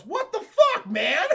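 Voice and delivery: high, loud offended yelp; indignant yelp. Retold or imitated. Hit Predator yelps at the flare thrower, loud and high. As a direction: yelp in comic outrage. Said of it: "Predator goes".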